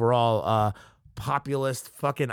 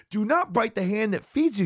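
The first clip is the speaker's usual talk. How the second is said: high, indignant scolding; heated scolding; 7 semitones higher than usual. Real, not acted. First, heated take of the scolding.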